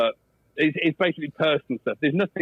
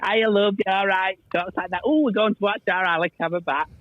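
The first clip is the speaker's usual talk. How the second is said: Lancashire mum; 5 semitones higher than usual. Retold or imitated. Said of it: "my mum was from Lancashire".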